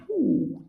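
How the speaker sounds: a high squeaky "uh"; short high squeal of effort. A narrator's reading. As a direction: squeal it out like the boy. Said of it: "chilló".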